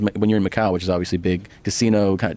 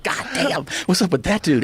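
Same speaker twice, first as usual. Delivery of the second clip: high, amazed gawking at a flashy outfit; mock amazement; 8 semitones higher than usual. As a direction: gasp it out like a stunned tourist. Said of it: "you're like".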